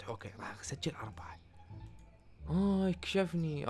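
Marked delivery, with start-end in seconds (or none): 0.0-1.4 whispered scheming aside; sneaky whisper. Pretend. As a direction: whisper the overcharge so nobody hears. Secretly overcharging the customer. (همس ماكر وهو يغش الزبون بالحساب)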